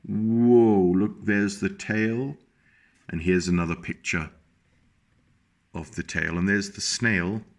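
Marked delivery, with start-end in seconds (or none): none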